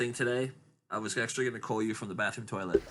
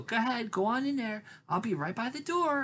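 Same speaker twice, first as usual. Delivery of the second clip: high-pitched mom voice; imitated mom; 11 semitones higher than usual. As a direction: send the kid in, mimicking a mother up high. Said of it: "You hear his mom go".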